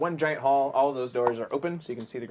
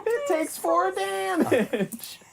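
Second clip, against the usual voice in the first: shrieking protest; 7 dB louder than usual. Real, not acted. GM squeals in protest.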